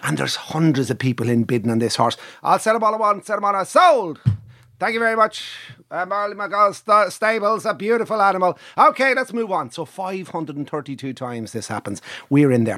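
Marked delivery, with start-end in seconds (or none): 2.4-9.7 high-pitched sale-ring patter; auctioneer. Acted. Auctioneer closing a sale and moving on, high brisk call. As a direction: knock it down and roll straight on.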